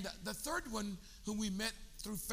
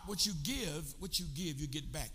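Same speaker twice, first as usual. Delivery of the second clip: ordinary talk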